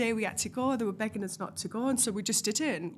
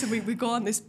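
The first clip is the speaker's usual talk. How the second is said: normal talking voice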